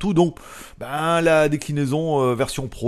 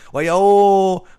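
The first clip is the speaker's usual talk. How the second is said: loud, higher call in a put-on Chinese accent; mock Chinese accent; 6 semitones higher, 9 dB louder than usual. Vlogger hails the Chinese assistant, loud mock Chinese accent. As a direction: bark the Chinese greeting at the speaker. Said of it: "Avec mon accent de psychopathe".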